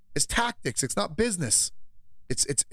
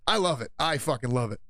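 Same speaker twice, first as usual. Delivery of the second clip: normal talking voice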